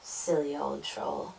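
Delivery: low, soft disapproval; quietly dismissive little goat; tutted. A narrator's reading. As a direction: tut it under your voice. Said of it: "he tutted".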